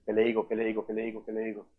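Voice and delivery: low, fading, repeated mumble; muttered worry. Acted. Believer unsure what to tell relatives, muttering to himself.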